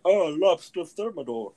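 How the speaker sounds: high, slow, snooty fancy-people voice; posh fancy person voice. Retold or imitated. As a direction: put on airs like a rich snob saying thermidor. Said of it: "fancy people food. Be like".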